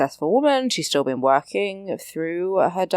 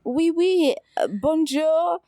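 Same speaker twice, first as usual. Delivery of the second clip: much higher, chirpy imitation of bad French; mock American French; 11 semitones higher, slower than usual. Imitating Carrie checking in at the hotel, mock French. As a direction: overdo the oui like an eager tourist. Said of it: "throwing in French words everywhere".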